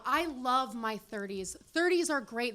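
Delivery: ordinary delivery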